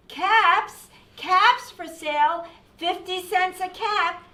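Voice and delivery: high, loud peddler's cry; street vendor's call. A narrator's reading. Peddler walking back to town, calling his caps. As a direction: call out wares to passers-by. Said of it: "he walked back to town calling".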